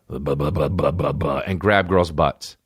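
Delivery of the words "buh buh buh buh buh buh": low grunted buh sounds; low put-on grunts; low mock grunting. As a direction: grunt it like a lech. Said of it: "who would be like".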